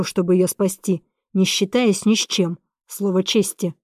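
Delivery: ordinary delivery, nothing marked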